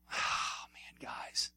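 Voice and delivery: very soft, whispered aside; heartfelt whisper to the group; hushed sigh. Real, not acted. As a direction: sigh it out almost without voice. Moved aside to the men.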